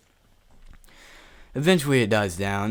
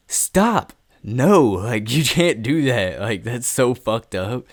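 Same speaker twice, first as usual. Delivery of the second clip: distressed protest; 3 semitones higher, 6 dB louder, much slower than usual. Retold or imitated. Telling her she can't do that, distressed protest. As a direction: stretch it out, appalled and insistent.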